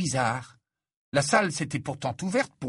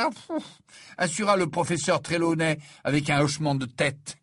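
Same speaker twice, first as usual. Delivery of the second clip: ordinary talk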